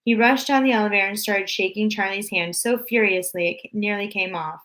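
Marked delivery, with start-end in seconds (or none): none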